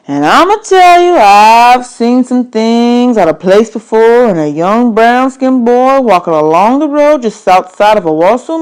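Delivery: a hint of a gruff stranger lecturing; stranger's voice.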